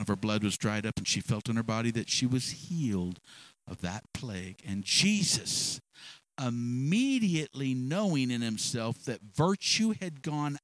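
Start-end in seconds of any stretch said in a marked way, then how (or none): none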